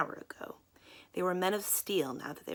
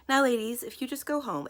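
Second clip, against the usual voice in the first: ingratiating; 7 semitones higher, 6 dB louder than usual. Acted. Crows dismiss the girls.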